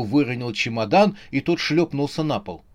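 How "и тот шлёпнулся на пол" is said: usual speaking voice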